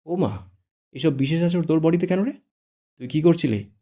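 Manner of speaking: high, loud mock surprise; exaggerated gasping tease; odd teasing tone. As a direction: tease him with fake shock. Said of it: "অদ্ভুতভাবে বলে".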